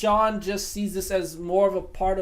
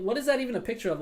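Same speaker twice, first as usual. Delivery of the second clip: ordinary delivery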